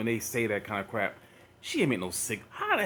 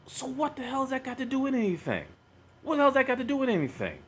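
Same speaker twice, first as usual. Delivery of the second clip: much higher, exasperated disbelief; pitched-up, fed-up demand, the second one faster; incredulous outburst; 10 semitones higher, faster than usual. Real, not acted. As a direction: throw the question up in outrage. Exasperated rant at the video.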